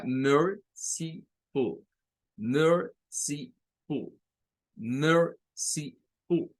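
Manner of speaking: «merciful» muy lento, por sílabas, con fuerza en MER; primera sílaba tónica. Shown as a demonstration. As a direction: separa «merciful» en MER-ci-ful, solo la primera fuerte. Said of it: "Las últimas dos no tienen fuerza de voz". («merciful» silabeado, acento en MER y dos últimas débiles)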